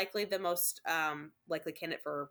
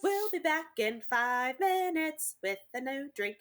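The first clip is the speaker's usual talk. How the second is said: announcer voice; 7 semitones higher, 4 dB louder, slower than usual. Pretend. Announcing a break to listeners.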